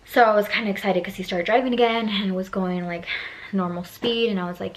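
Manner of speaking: usual speaking voice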